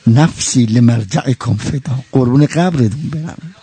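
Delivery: low, slow, tender murmur; slow hushed devotion. Acted.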